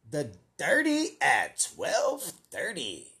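slow, drawn-out announcer title. Acted. Host announcing the boss's new bit, hammed-up announcer. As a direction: announce it like a show segment. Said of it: "he has a new bit, and it is called".

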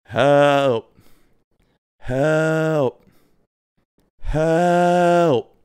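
long mock distress call; mock yell for help. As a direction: call out for help, stretching the word. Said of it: "start yelling".

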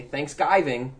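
"Thanksgiving" as thanks-GUY-ving; mocking mispronunciation. Teasing how co-host sounds, "Thanksgiving" with GUY. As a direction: swap the vowel in "Thanksgiving" to guy. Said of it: "That's what you sound like".

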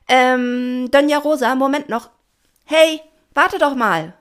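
loud, high, urgent shout; shouting after someone. A narrator's reading. Pina shouts after Doña Rosa to make her wait. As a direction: yell to stop her swimming off. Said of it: "schrie Pina".

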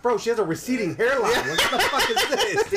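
high, loud, laughing disbelief; incredulous protest. Retold or imitated. Arguing with his buddy about a dating photo, high-pitched incredulous protest. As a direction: protest in a rising, laughing yell. Retold argument over a photo.

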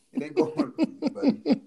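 high, laughing through it; laughing tease. Real, not acted. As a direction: tease him while cracking up. Teasing his friend.

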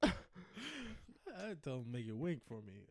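slow, soft sultry line; slow soft sultry purr; sultry mock. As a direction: purr it slowly. Seductive repeat.

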